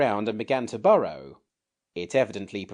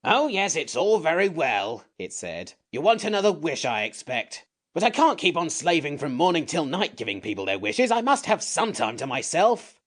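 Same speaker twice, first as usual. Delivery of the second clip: higher, louder cross creature voice; grumpy sand creature; 9 semitones higher, 4 dB louder than usual. A narrator's reading. The Samiad protests being held, grumpy creature voice. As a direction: snap like a cranky little beast.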